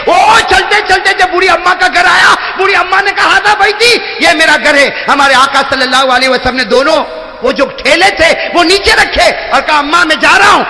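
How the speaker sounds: fervent high preaching shout; preaching at full force. Acted. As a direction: shout the blessing out to the crowd.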